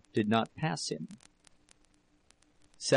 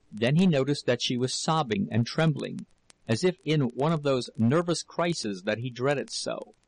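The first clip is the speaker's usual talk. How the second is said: ordinary delivery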